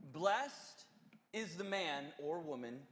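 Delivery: slow, solemn reading of a psalm; scripture reading. Acted. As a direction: read it like holy text, deliberately. Reads Psalm one aloud.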